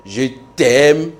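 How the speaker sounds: "Je t'aime" with "t'aime" drawn out and rising; changed tone. Shown as a demonstration. Teacher varies the French phrase, rising tone on "t'aime". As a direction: lift "t'aime" loudly at the end. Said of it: "lorsque tu changes un peu de ton".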